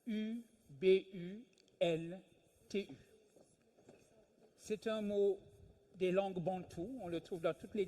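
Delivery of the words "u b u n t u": Ubuntu spelled slowly, one letter at a time: U, B, U, N, T, U; spelling it out. Shown as a demonstration. To a lecture audience, spelling Ubuntu letter by letter. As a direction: spell out each letter of Ubuntu with a pause between. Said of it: "et ce mot est Ubuntu".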